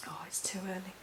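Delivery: real under-the-breath near-whisper; soft, half-whispered grumble to self; muttered aside. Real, not acted. Presenter to self after stumbling on a name, muttered aside.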